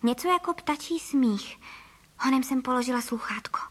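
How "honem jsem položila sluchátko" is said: ordinary delivery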